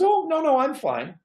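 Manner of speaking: lightly voiced old lady waving off concern; elderly Betty voice. Betty voiced in retold call.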